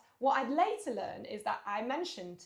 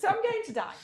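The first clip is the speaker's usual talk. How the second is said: high, louder, laughing mock panic; 11 semitones higher, 7 dB louder than usual. Telling friend about giving birth, mock panic with laughter. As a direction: laugh out the punchline of the birth story.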